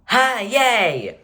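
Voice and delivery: high, bright "yay"; mock cheerful. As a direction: fake cheerful greeting.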